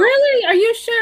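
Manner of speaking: high, startled disbelief; surprised. Real, not acted. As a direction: ask it in real surprise. Doubting a claimed accent.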